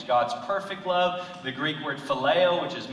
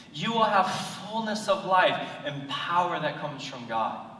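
ordinary delivery

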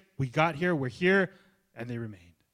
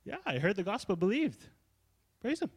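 mock cheerful ending; 7 semitones higher than usual. Pastor mocking Lydia's tidy ending, higher and chipper. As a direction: pitch jumps up on a chipper wrap-up. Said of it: "Lydia's might have sounded like this".

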